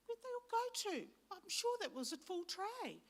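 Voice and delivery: high, playful puzzlement; mock puzzled. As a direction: act baffled the tray is empty. Mock puzzlement about biscuits.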